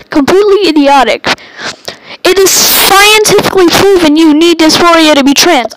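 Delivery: loud, angry yelling; furious shout. Real, not acted. Yelling at narrow-minded viewers, real anger boiling over. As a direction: blow up and yell it at them. Ranting angrily at critics.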